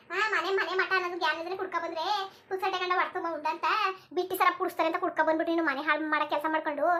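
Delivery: ordinary talk, nothing marked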